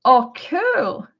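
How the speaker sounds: normal talking voice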